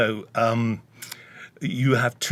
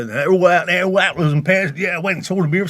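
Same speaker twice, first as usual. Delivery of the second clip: loud, higher Cockney patter; East End London accent; 5 semitones higher, 5 dB louder than usual. Shown as a demonstration. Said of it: "down the east end of London".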